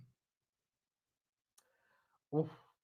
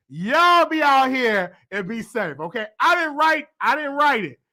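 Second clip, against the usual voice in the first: normal talking voice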